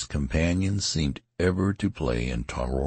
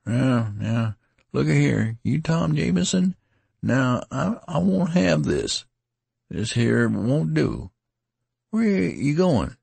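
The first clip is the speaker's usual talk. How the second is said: higher pleading rustic voice; begging his friend to stay; lightly voiced distressed soldier; tattered man; 12 semitones higher, 3 dB louder than usual. A narrator's reading.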